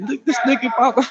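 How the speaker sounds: high mock jeer; sing-song taunt, pointing someone out; imitating a schoolkid jeering; mocking kids. Kids mocking him at show.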